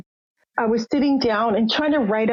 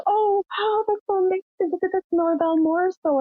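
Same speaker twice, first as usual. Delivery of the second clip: nurse's delight; 9 semitones higher than usual. Retold or imitated. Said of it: "And she goes".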